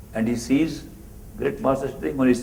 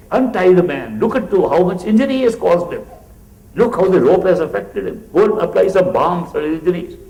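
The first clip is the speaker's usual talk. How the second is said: firm command; 6 semitones higher, 6 dB louder than usual. Retold or imitated. Master orders attendants.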